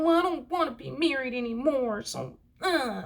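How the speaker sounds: high, whiny mock-kid voice; imitating a childish complainer; talking like a child. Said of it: "they talk like one".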